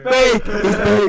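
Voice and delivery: high loud mocking yell; teasing shout. Real, not acted. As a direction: call him out loudly. Friends accusing in jest.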